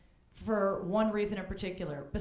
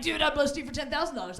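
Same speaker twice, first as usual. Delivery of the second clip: eager dude friend; 6 semitones higher than usual. Retold or imitated. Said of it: "He's all like".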